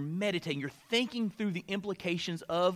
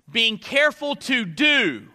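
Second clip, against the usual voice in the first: slow, with "do" drawn out and hit hard; stress on "do"; 4 semitones higher, 6 dB louder, slower than usual. Shown as a demonstration. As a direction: land heavily on the final "do". Preacher has listeners underline "do.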